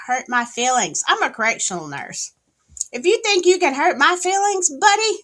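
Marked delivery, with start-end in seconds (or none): 2.9-5.2 loud taunt. Real, not acted. Taunting a chatter.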